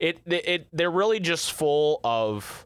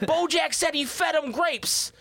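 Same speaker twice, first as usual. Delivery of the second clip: much higher hammed-up Boston accent, slowing down; Boston brother. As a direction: squeak out the starstruck Boston brother, drawn out. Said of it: "And the exchange is".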